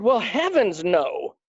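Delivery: playful, drawn-out no, the last one jumping high; hammed-up coy no; mock refusal. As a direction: tease him with an over-the-top refusal. Teasing refusal in retold story.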